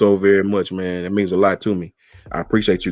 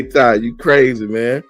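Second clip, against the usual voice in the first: ordinary delivery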